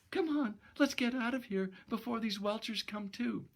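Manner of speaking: put-on high young woman's voice, urgent; high girlish tone, hurrying him. Gloria's voice.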